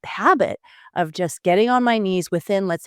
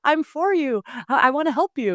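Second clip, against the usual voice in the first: higher, lightly gushing newlywed; mock newlywed gush; 9 semitones higher than usual. Newly married spouse to partner, high gushing. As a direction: pitch it up like a smitten newlywed. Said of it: "No, you were like".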